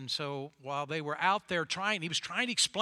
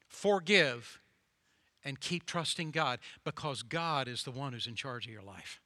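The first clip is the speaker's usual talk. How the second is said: ordinary talk, nothing marked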